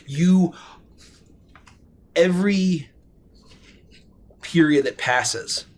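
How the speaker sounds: ordinary talk, nothing marked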